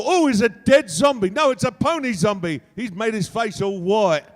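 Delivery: loud, high, excited London accent; Cockney-style accent. Shown as a demonstration. Telling a zombie story to a crowd, loud Cockney-style accent. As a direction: rant in exclaiming Cockney. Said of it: "add an accent".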